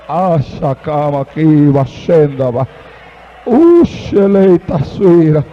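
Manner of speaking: low, slow, otherworldly tongues; speaking in tongues. Real, not acted. Preacher to the congregation, low slow speaking in tongues. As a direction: drop deep into prayer tongues, slowly.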